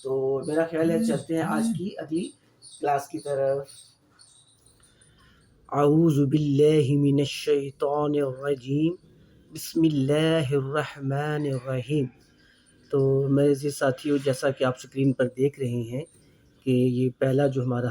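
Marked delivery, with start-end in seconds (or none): 5.7-12.1 slow, measured Arabic recitation; Quranic recitation. Acted. Teacher opening the class, reciting slowly. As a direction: intone the opening words like a reciter.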